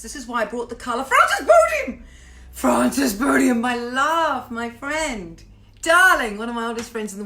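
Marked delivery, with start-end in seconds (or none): 1.0-2.0 high, loud delighted cry of a name with a laugh; delighted shout. Real, not acted. Host spotting an old friend's name in the chat, delighted shout. 2.5-6.4 excited greeting. Real, not acted. Host greeting an old friend in the chat, excited.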